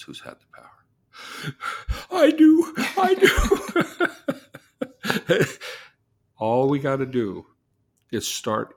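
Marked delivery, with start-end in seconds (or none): 0.0-0.8 whispered. Acted. Pointed question to the client. 1.1-2.0 gasp. Retold or imitated. Client realizes her power. 2.1-4.1 high squeal with laughter; gleeful. Retold or imitated. Client realizes her power. 4.8-5.8 giddy laugh. Retold or imitated. Client's joyful reaction.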